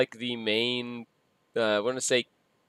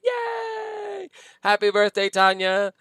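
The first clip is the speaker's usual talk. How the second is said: high, squealing birthday yay; excited cheer; 10 semitones higher, 4 dB louder, slower than usual. Real, not acted. Celebrating co-host's birthday.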